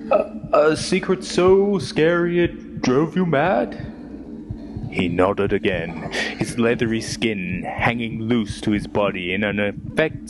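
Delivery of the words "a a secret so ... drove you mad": slow, high, hesitant asking; wary question. Acted. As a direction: coax the secret out slowly. Questioning the cave creature.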